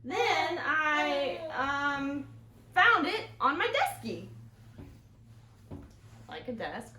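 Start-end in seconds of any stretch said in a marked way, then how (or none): 0.0-4.3 put-on high, loud hesitant kid voice; stall, then blurt the silly word; fibbing boy. Henry inventing his excuse to the teacher, higher and louder. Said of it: "said Henry".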